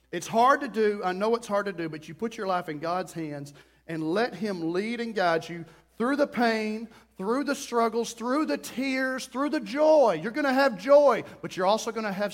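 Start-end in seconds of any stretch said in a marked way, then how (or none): none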